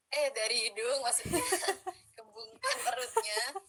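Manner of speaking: giggling correction; laughing at own slip. Real, not acted. Coach corrects her own slip, laughing.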